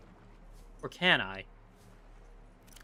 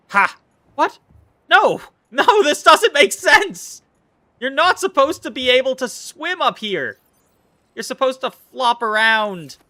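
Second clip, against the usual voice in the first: much higher, louder hammed-up yelling; mock outrage; 8 semitones higher, 9 dB louder than usual. Streamer fake-raging at a fish on land, hammed-up loud outrage. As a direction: yell at the fish like a cartoon villain. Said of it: "I'm mostly just playing".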